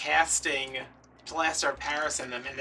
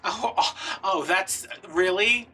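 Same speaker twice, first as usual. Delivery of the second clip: mock surprise; 5 semitones higher, 6 dB louder, slower than usual. Mocking saleswoman's fake surprise.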